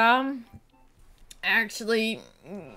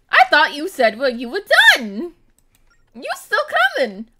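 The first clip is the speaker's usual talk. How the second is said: loud, high playful outburst; loud teasing; 5 semitones higher, 16 dB louder than usual. Real, not acted. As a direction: call it out teasingly.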